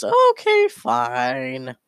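very high squeaky concession; squeaked-out little critter reply; Space Squirrel giving in. Acted. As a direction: give in, way up high. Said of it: "Space Squirrel goes".

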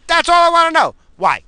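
high, loud insistent shout; exasperated yell. Real, not acted. Host insisting he just wants to know, exasperated yell. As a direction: repeat it at a yell, every word pushed.

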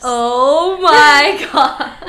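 loud laugh through the words. Real, not acted. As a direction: burst out laughing mid-phrase. Laughing at gross pun.

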